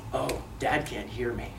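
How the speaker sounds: soft, low voice of a dawning realization; thinking to himself. Retold or imitated. Boy realizes headphoned Dad can't hear, acted hushed thought. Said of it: "Levi thinks to himself".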